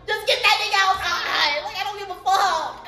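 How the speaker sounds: high, loud, giddy demand; mock-exasperated yell; retold impatient outburst. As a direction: blurt it out high, half laughing.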